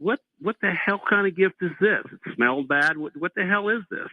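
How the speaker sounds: high, exasperated what-the-hell, retold; recoiling from a bad smell, half mock; disgusted outburst. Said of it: "And I went".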